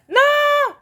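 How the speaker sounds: high, loud shriek of no; scream. Real, not acted. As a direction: scream at the game in panic. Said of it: "Désolée pour les cris d'avance".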